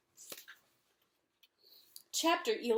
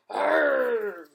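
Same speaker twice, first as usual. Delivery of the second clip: loud, drawn-out monster roar; ogre roar; 3 semitones higher, 7 dB louder than usual. Acted. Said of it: "The ogre roared".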